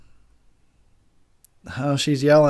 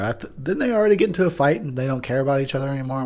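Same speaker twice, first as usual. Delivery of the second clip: ordinary delivery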